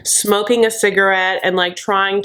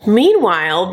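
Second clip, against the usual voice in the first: ordinary talk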